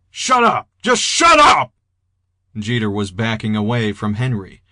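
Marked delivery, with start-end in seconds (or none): 0.1-1.7 high, loud outburst; panicked shout. A narrator's reading. Jeter backing away from Henry, panicked high shout. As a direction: yell it, voice leaping way up.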